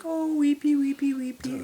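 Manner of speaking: high singsong fake crying; mock weeping. Co-host mocks actress crying on camera, mock weeping. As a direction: mimic a whimpering actress in a high voice. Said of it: "she's crying the entire episode".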